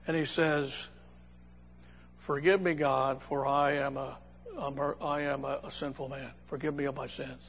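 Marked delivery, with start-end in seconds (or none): 2.2-7.4 low, hushed plea sinking away; halting, soft confession with head down; humble. Acted. Tax collector looks down and begs God's forgiveness, humbly.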